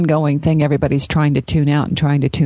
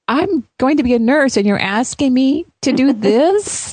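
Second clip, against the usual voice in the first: high, incredulous protest; a student nurse balking at a nail trim; indignant nurse; 8 semitones higher than usual. Retold or imitated. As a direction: act out disbelief at the ask, pitched way up. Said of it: "I liked your response of".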